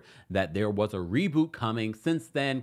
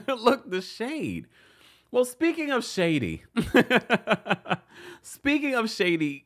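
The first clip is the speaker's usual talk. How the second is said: high, laughing through words; giggling at the shade; 10 semitones higher than usual. Real, not acted.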